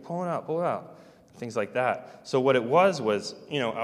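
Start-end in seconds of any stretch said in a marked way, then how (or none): 0.0-1.0 imitated urgent voice. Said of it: "like, saying, like".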